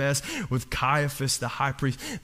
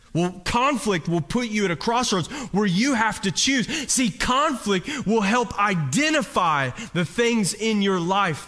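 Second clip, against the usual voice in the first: preaching at full force; 6 semitones higher than usual. Real, not acted. Preacher singing the point out to the congregation. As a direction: ring it out to the congregation.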